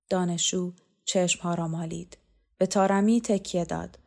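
ordinary delivery, nothing marked

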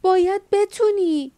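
a fierce, pleading cry across the storm; desperate shout. A narrator's reading. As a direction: shout it high and loud, slowly. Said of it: "جاسمین فریاد زد".

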